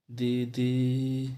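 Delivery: दीदी said very slowly, the final ई stretched long; drawn-out call. Shown as a demonstration. Teacher calling a sister with a long ई in दीदी. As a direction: hold the last ई of दीदी like calling from afar. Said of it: "तो ई आ रहा है".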